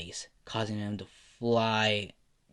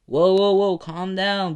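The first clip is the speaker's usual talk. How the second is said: much higher and louder, arms-up calming; loud placating; 8 semitones higher, 8 dB louder, slower than usual. Acted. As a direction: call out to make him hold off. Izuku confronting armed boss.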